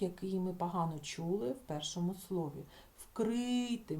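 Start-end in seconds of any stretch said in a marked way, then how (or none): 3.0-4.0 "Вкрити" with a higher, clear stressed И. Shown as a demonstration. Teacher proves the vowel is clearly heard, raised И in "Вкрити". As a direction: lift and lengthen the first И in "Вкрити". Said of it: "Ми чітко чуємо и".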